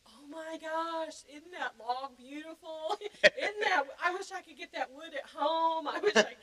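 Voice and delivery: breathless rapture at a pretty log; gushing excitement. Retold or imitated. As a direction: swoon over the scenery like on the video. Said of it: "you can hear me through the whole thing".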